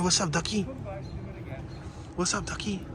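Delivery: hushed greeting to a duck; soft coaxing. Real, not acted. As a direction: say it softly, as to a timid animal. Softly greeting a nearby duck.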